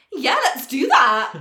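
loud, slow, gleeful yes; eager agreement. Retold or imitated. Two friends agreeing to steal the fishbowl, loud and slow. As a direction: boom it out, conspiratorial and thrilled. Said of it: "We go".

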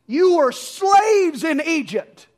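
slow, high, loud emphatic rebuke; incredulous rebuke. Acted. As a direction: spell it out in disbelief.

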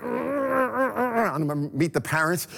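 high, slow, put-on voice of dread; high slow mock dread. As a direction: drag it out like someone dreading the in-laws. Congregation's frustration acted out.

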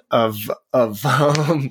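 losing the thread to laughter; laughing. Real, not acted. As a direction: search for the word, then crack up. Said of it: "you're laughing about it".

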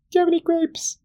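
very high little duck voice. Acted. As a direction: pipe it up high like a tiny duck. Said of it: "Duck looks up at the bartender".

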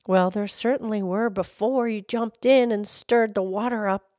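ordinary delivery, nothing marked

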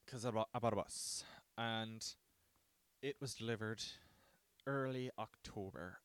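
ordinary talk

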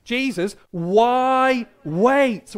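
loud rallying question; loud calling out. Acted. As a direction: call it out big and high, stretching "why" and "wait". Calls out the question to kids.

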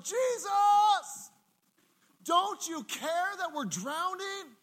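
frightened disciples yelling over the storm; panicked cry for help. Retold or imitated. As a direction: cry out to a sleeping Jesus in terror.

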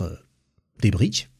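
normal talking voice

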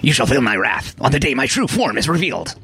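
put-on menacing Glow Baby voice. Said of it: "it was in the Glow Baby voice".

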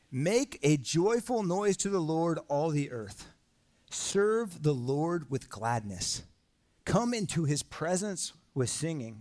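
slow, solemn reading of the psalm; scripture reading. Acted. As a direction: read each line slowly, like a declaration. Reads psalm aloud to congregation.

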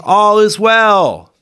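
loud, high, drawn-out call. A narrator's reading. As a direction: call it out slowly to the king. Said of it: "called and said to the king".

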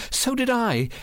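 high, frightened agreement; frightened woman's high voice; trembling voice. A narrator's reading. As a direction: agree fearfully, pitched right up. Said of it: "in a trembling voice".

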